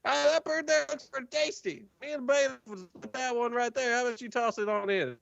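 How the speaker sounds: high, folksy drawling prisoner; chatty, smug old codger; Jorge voice. Acted. Jorge explains the prisoners' departure, high and folksy.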